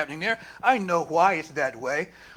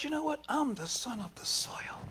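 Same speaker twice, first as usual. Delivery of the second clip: hushed vow; 5 semitones higher, 10 dB quieter than usual. Retold or imitated. Traore answers his attackers in a whispered vow. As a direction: breathe it like a secret oath. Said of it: "But he said".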